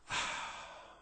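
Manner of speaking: long, breathy sigh; upset sigh. Acted. Boy telling granddad his troubles, upset sigh. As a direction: breathe out heavily mid-story.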